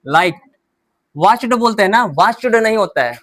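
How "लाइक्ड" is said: लाइक्ड said laikt with a short t; teacher asks students to repeat लाइक्ड; ending like t. Shown as a demonstration. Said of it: "लेकिन लाइक्ड बोलिए".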